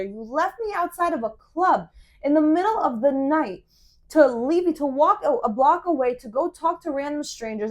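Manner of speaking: re-enacted lovers' quarrel, worked up; indignant tirade. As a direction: pile up grievances, hitting the key words hard. Retold confrontation with boyfriend.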